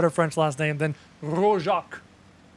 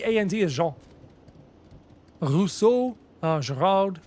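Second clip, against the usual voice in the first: usual speaking voice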